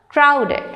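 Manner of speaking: "crowded" with CROW high, falling after; stress on first syllable. Shown as a demonstration. Teacher reading words to students, "crowded" stressed on CROW. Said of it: "stress on the first syllable".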